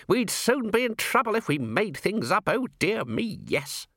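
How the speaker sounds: put-on high-pitched tutting old man; fussy old herald. Herald reproving Vimes from behind him, high fussy voice. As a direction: fuss away in a high voice.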